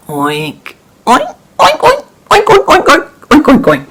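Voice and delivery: high squealing pig noises; pig oinks. Acted. Farmer oinking.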